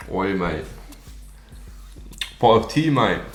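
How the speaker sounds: jokey mock-British accent; hammed-up British "mate" talk. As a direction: put on a playful UK accent. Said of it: "U K accent".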